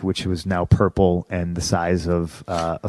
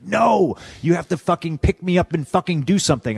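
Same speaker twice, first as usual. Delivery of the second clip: high, exasperated refusal; retold heated urging; insistent objection; 7 semitones higher than usual. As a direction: push back hard with the pitch jumping up. Retold urging of timid opponent.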